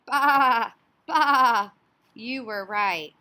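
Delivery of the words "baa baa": very loud bleating baa; sheep baa. Acted. Teacher cueing the sheep's sound for her class, loud bleat. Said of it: "If you said the sheep".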